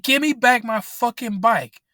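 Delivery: mock-shout at the thief; yelling at a bike thief to hand it back; angry retold yell. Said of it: "And I'm like".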